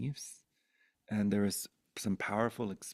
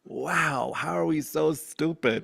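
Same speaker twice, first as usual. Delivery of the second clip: higher, louder, incredulous; exasperated; 5 semitones higher, 5 dB louder than usual. Real, not acted. Telling the interviewer how baffling people are, exasperated. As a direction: throw up your hands in disbelief. Exasperated rant about humanity.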